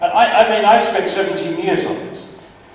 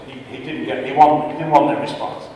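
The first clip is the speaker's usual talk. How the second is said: ordinary delivery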